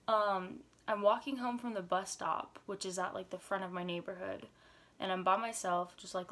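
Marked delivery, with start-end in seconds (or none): none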